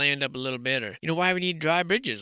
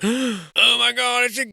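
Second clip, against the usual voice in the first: surprised gasp; 8 semitones higher, 6 dB louder than usual. Real, not acted. Spotting a goose in the photo, startled.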